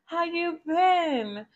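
high, sugary put-on voice of a fake friend; fake friendly acquaintance. Nosy acquaintance fishing for gossip, high fake-sweet voice. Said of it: "They're gonna be like".

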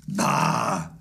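low, drawn-out dismissal; grumpy old miser scoffing; scornful Scrooge. Acted. Scrooge scorns nephew's greeting.

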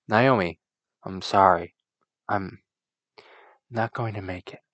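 put-on low, soft, fading dying boy; lightly voiced dying schoolboy; dying Satoshi. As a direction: gasp out final words, weak and low.

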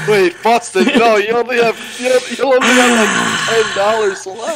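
loud, high teasing about the money; excited teasing. Real, not acted. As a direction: rub it in gleefully. Friends mocking player's low cash.

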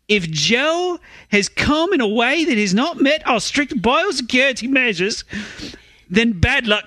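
the minister in a fake Australian accent, voice pushed up; bad Australian accent. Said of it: "that's the Prime Minister speaking".

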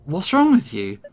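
plain reading voice

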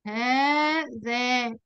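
هذا stretched very slowly, long aa; drawn-out demo word. Shown as a demonstration. Teacher sounding out demonstratives for the class, very slow. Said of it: "لما أقول".

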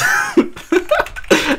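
squeaky burst of laughter; high-pitched laugh. Real, not acted. Streamer joking with new friend, squeaky laugh.

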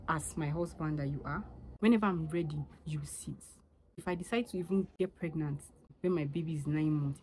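ordinary talk

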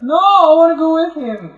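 high, loud yelling. Real, not acted. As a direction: yell it in protest. Yelling in firefight.

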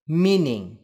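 "meaning" stressed on MEAN; stress on first syllable. Shown as a demonstration. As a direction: hit MEAN in "meaning", let the ending fall. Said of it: "rơi vào âm tiết đầu tiên". ("meaning" nhấn âm tiết đầu)

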